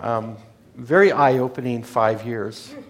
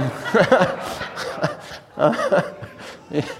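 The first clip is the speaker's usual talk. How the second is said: breathy laugh; 4 semitones higher than usual. Real, not acted. Amused at his own aside.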